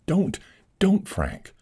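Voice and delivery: high anxious begging; pleading lady. Acted. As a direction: beg him to stop. Lady begs him.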